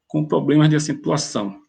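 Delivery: ordinary delivery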